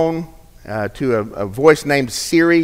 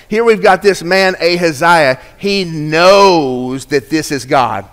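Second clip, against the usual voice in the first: ordinary talk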